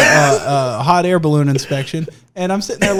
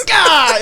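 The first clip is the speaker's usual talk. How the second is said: mock angry grunt; 10 semitones higher, 6 dB louder than usual. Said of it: "and just went".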